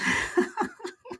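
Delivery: high, soft giggle; laughing. Real, not acted. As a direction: break into a light, high-pitched laugh. Laughs at chat comment on pink.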